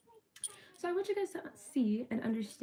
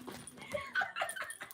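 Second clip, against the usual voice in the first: very high squealing laugh; 5 dB quieter than usual. Real, not acted. Vlogger to viewers after a joke, squealing with laughter.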